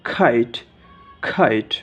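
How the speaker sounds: "খাইট" with a breath puff on k, low fall; aspirated K. Shown as a demonstration. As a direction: puff the first sound of "খাইট". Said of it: "যেমন". ("খাইট" মহাপ্রাণ খ দিয়ে, নিচু সুরে)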